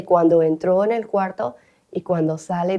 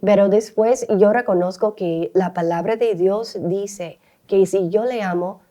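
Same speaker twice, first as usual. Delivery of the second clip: normal talking voice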